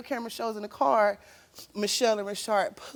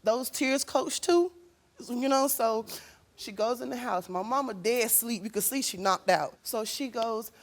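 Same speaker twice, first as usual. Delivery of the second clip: ordinary delivery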